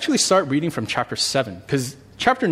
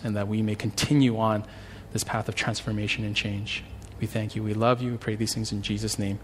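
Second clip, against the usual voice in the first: softer, hushed prayer voice; 3 semitones lower, 4 dB quieter than usual. Acted. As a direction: pray humbly to the Lord, quietly. Pastor leads opening prayer.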